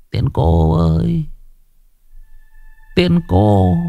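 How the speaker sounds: high, dreamy lovesick sleep-talk; a sleeping boy pleading with a fairy; mumbling in his sleep. Acted. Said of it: "tự nhiên nó lẩm bẩm". (nói mớ, giọng cao mơ màng)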